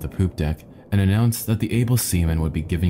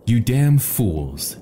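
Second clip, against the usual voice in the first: understated narrated shout, higher and drawn out; slow, raised-pitch scolding, short of a full yell; angry shout; 5 semitones higher, 3 dB louder, much slower than usual. A narrator's reading. Cabin boy scolding passengers and crew, mildly shouted anger. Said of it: "he shouted".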